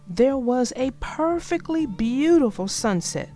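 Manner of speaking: plain reading voice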